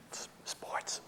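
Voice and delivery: sheepish whisper with a stammer; barely audible admission. Retold or imitated. Husband confesses topic to wife, sheepish whisper. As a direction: whisper it reluctantly. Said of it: "I didn't hear that".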